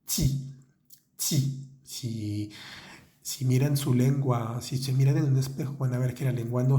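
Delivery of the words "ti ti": ti whispered, tongue behind the teeth; whispered French ti. Shown as a demonstration. Teacher repeats the correct French ti for students.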